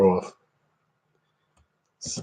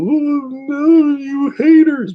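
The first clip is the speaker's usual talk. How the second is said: very high, louder mocking whine; mocking her whine; 4 dB louder, much slower than usual. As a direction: squeal it like a prissy hater-hater. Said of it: "when she's all".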